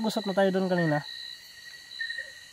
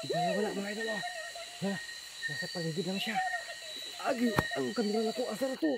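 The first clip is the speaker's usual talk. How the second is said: soft breathless talk; hushed shaken report to viewers; 4 semitones higher, 9 dB quieter, faster than usual. Real, not acted. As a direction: recover quietly after the fright. Shaken aftermath, quiet.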